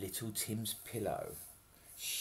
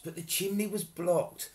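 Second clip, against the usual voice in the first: usual speaking voice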